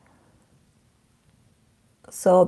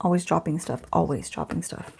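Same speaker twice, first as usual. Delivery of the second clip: soft grumble under her breath; muttering to herself; 4 semitones lower, 12 dB quieter than usual. Real, not acted. After dropping something again, she mutters softly to herself. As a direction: mutter it to yourself, annoyed at being clumsy.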